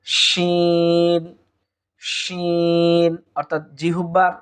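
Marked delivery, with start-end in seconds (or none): 0.1-3.1 শিন held long with a hushed sh; drawn-out Arabic shin. Shown as a demonstration. Teacher modelling শিন for learners, drawn out. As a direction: stretch শিন slowly. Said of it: "শিন হরফটি আমরা পেয়ে যাব".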